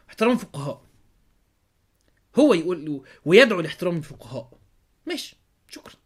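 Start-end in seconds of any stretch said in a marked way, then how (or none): none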